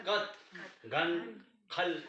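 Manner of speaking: कत, गन, खल each as one light syllable; spaced, lightly said कत, गन, खल; light short vowels. Shown as a demonstration. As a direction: keep the a short in कत, गन, खल. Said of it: "इस तरह से हल्के में".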